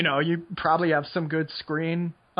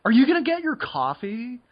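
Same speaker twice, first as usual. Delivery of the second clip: imitating a Seattle yuppie accent, higher pitched; mock Seattle yuppie; 8 semitones higher, 4 dB louder than usual. Neighbours in a yuppie Seattle block, imitated in a high, mock yuppie accent. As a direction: put on a light, high yuppie Seattle lilt. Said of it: "And everybody is like".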